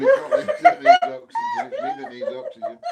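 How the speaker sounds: very high, squeaking through laughter; words squeezed out by laughter; laughing hysterically. Real, not acted. Husband to wife, squeaky laughing fit. Cracking up with partner.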